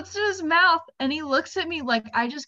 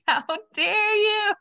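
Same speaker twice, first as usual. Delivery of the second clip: much higher, slow, mock-indignant; child outraged at the sneaky applesauce; offended little kid; 11 semitones higher, 4 dB louder, slower than usual. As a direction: act out a toddler's betrayed outrage. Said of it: "And he's like".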